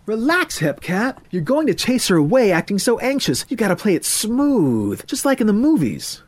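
high, lively cool-cat voice; jive-talking hepcat friend. Acted. Hepcat friend tells anxious Murray to relax, cocky. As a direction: coach him with high-pitched swagger. Ethan coaches his friend.

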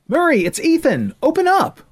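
high, loud call through a door. Acted. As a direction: shout to be heard through the door. Calling through door at night.

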